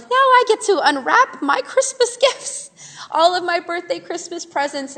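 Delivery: put-on teacher to wrapped-up kids, playfully thrilled; gleeful excitement. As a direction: gloat happily over a pile of presents. Pretending kids are her gifts.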